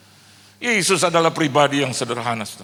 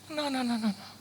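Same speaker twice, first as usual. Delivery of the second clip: put-on child insisting; 10 semitones higher, 10 dB quieter than usual.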